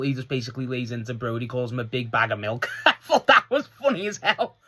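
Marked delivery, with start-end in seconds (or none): 2.8-4.7 high, laughing through the words. Real, not acted. Vlogger laughing at MJF's insult, laughing. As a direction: crack up while you say it.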